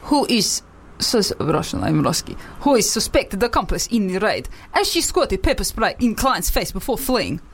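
news line read in a put-on Russian accent; wobbly amateur Russian accent. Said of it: "just a Russian at the end".

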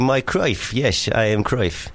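put-on faltering Dutch accent; improvised Dutch caller, losing the thread. Hotel guest giving his name on the phone, Dutch accent. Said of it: "you're Dutch".

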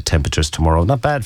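ordinary delivery

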